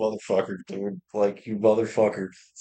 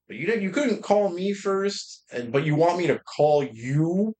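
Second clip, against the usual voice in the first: higher, rising indignation; indignant retort; 8 semitones higher than usual. Retold or imitated. Telling dad off about the Facebook cancer post, indignant and higher. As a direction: pitch climbs as the grievance builds.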